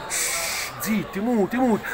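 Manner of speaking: soft hushing taunt. Acted. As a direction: shush them quietly. Hushing the rival fans.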